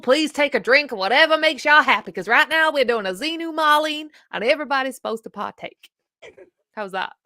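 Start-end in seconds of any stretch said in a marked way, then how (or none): 0.0-5.9 loud hammed-up Southern accent; a Southern belle calling the room to drink; Southern drawl accent. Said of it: "that's one great accent you do".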